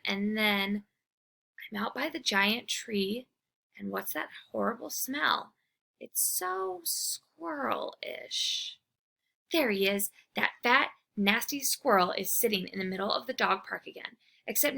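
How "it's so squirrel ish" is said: disgusted. Acted. Disgusted by smell.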